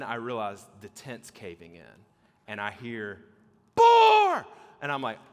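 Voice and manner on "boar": sudden high, very loud shout; panicked yell. Retold or imitated. Tentmate yelling in a night terror, panicked shout. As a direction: yell it out like an alarm.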